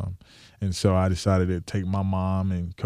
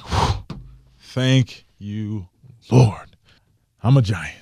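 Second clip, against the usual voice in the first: relieved thanks; 3 semitones higher, 3 dB louder, much slower than usual. Retold or imitated. Relief when the Giants call.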